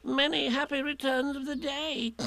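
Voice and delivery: high, thin little animal voice; Piglet. A narrator's reading. Piglet wishes Eeyore happy birthday, high and piping. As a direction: pipe it up high on a steady note. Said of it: "said Piglet again".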